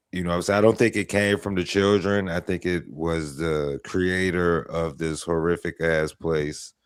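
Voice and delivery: normal talking voice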